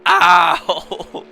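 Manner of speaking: loud, sudden "ow" shout; real pained cry bursting out; yelp of pain. Real, not acted. Commentator hurt by a violent hiccup, loud pained yelp. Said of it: "That really hurt".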